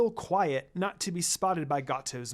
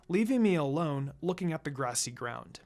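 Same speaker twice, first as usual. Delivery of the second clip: ordinary delivery, nothing marked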